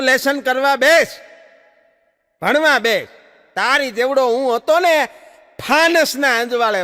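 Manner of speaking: father scolding son to study. Retold or imitated. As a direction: scold the lazy son hard. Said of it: "ખીજાણો".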